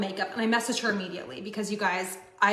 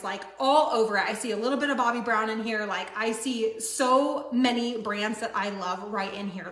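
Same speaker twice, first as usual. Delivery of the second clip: normal talking voice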